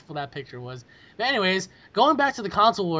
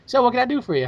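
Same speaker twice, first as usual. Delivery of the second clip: cheery shopkeeper voice; 6 semitones higher, 4 dB louder than usual. Retold or imitated. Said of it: "the guy was just standing there, like".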